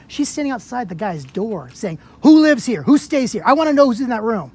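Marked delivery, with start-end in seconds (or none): 2.2-4.5 loud, pushy mock interrogation; retold loud demand. Officer demanding at the door who lives there, loud. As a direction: bark the questions like the officer did.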